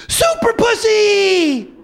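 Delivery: very high, loud, drawn-out holler; piercing comic yell, stretched long; hammed-up old lady cry. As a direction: shout it like a gleeful superhero entrance. Old lady calls out in joke.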